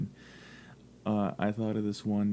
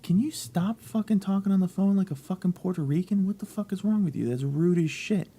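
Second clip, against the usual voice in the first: higher-pitched, exasperated telling-off; annoyed outburst; 11 semitones higher, 3 dB louder than usual. Retold or imitated. Retelling how he scolded the girl on the phone, annoyed and high. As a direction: re-enact being fed up, voice pitched well up. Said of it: "I was just so fucking annoyed".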